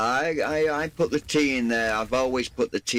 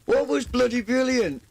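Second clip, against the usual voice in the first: much higher, a mock English lilt; mock British phrase; 10 semitones higher than usual. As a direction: pitch it up, aping a Brit.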